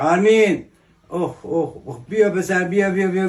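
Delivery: low deep voice. Acted. Character calls boy over.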